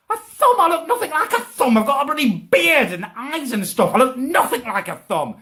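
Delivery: high-pitched comic outrage; indignant disbelief. Real, not acted. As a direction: protest the insult, squeaking with indignation. Indignant at being called a thumb.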